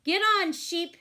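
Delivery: loud urging call; shouting. A narrator's reading. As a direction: shout at the sheep to hurry. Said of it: "everyone shouted".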